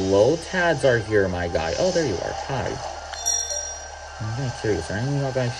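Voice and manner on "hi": ordinary talk, nothing marked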